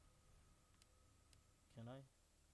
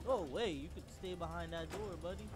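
taunting shout; 5 semitones higher, 6 dB louder than usual. Real, not acted. Talking to enemy behind door.

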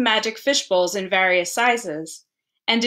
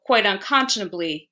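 usual speaking voice

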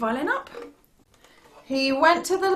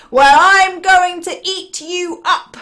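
slow, loud, raised troll threat; menacing troll; 7 semitones higher, 9 dB louder than usual. Acted. Terrible troll threatens the little goat, slow and loud. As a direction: draw out the threat with relish.

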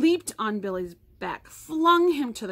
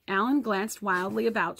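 ordinary delivery, nothing marked

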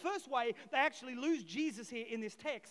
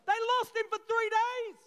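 much higher and louder; emphatic outcry; 9 semitones higher, 7 dB louder than usual. Real, not acted. Preacher hammering to church, high and loud. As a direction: cry it out in disbelief.

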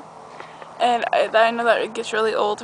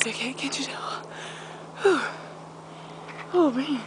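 out of breath; 8 dB quieter than usual. Real, not acted. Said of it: "I'm, like, out of breath".

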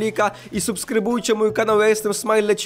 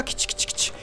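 whispered kitty call; 9 dB quieter than usual. Real, not acted.

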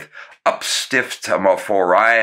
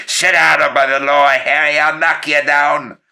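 louder, higher mock threat at Walter; gruff threat; 9 semitones higher, 5 dB louder than usual. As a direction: roar it like a hot-tempered uncle.

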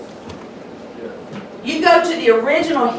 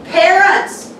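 fiery preaching; 5 semitones higher than usual. Acted. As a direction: pound the pulpit with rising fire. Preaching at full force.

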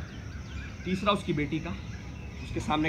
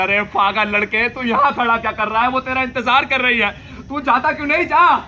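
higher, louder, rising to a yell; urging shout; 8 semitones higher, 9 dB louder than usual. Acted. Inner voice shouting at the hesitant young man. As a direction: egg him on harder and harder. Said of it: "जानलेवा किरकिरा".

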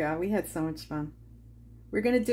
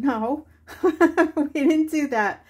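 higher and louder, laughing through it; laughing reply; 6 semitones higher, 7 dB louder than usual. Retold or imitated. Telling friend Paula no, laughing through the answer. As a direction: laugh out the denial. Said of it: "And I said".

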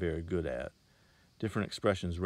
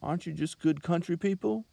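high, pleading question rising at the end; 11 semitones higher, 6 dB louder than usual. A narrator's reading. Girl pleading with the Bible salesman, high and rising. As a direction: beg him to be who she thought. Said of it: "had an almost pleading sound".

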